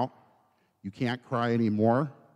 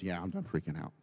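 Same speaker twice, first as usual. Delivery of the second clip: low, subdued admission; soft, spent surrender; calmed down; 5 semitones lower, 13 dB quieter than usual. Acted. Psalmist acted out, answering God after panicking, calmed down.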